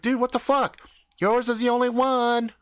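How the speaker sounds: high, protesting mock yell; indignant yelling. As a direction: protest with pitch pushed right up. Acted squabble over the only toilet.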